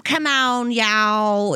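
thick Southern twang with stretched vowels; mock a thick Southern drawl; rough Southern accent. Shown as a demonstration. Mother of six calling kids in thick Southern twang. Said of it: "It sounds like you have just".

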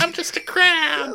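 very high, slow tiny-creature voice; little crab. Acted. Crab creeping up the captive, tiny innocent voice. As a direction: squeak it innocently, drawn out. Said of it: "the crab's like".

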